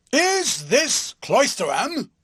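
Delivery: big booming high demand, slow; tremendous voice. A narrator's reading. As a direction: boom it out like a huge bully. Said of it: "demanded the passenger in a tremendous voice".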